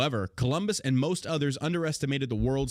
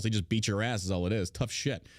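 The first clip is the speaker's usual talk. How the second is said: ordinary talk, nothing marked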